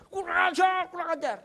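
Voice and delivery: high, loud furious cursing that fades; raging while being restrained; angry cursing. Retold or imitated. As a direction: keep spitting the insult as he is held back. Brother keeps cursing while restrained.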